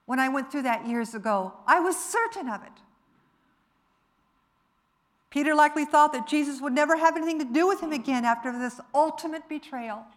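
impassioned preaching. Real, not acted. Preacher confessing they once felt like Peter, impassioned. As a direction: press it home emphatically.

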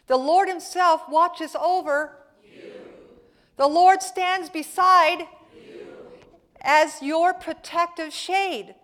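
slow, loud, high preaching of the psalm; preaching at full force. Acted. Pastor booming Psalm 121 to the congregation, slow and loud preaching. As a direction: thunder each line of the psalm slowly to the church.

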